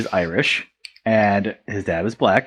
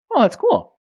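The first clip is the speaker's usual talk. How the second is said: much higher, hammed-up impressed reaction; mock surprise; 13 semitones higher than usual. Teller reacting to his friend's English birth, in hammed-up mock surprise. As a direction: pitch it way up as if impressed.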